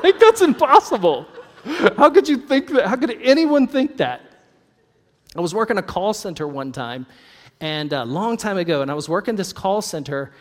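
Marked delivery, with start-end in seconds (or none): 0.0-4.2 high, loud incredulous protest through laughter; comically aghast, pitched way up; mock disbelief. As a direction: cry out as if the opinion is absurd. Mock outrage at an imagined opinion.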